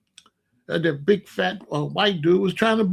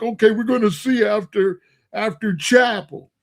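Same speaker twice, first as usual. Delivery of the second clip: bully's threat; 5 semitones higher, 4 dB louder than usual. Retold or imitated.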